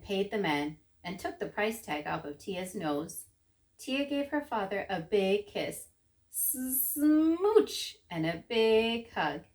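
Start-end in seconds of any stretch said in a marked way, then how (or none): 6.3-8.0 exaggerated high kissing noise; smooch sound. A narrator's reading. Tia gives her father a big kiss, playful smooch. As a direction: draw out the hiss into a big smooch.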